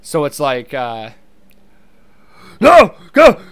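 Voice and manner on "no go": loud, high, frantic comic actor; urgent, panicky shouting, hammed up; panicked Seth Rogen. Shown as a demonstration. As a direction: yell in high-pitched panic. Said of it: "only, like, panicked Seth Rogen".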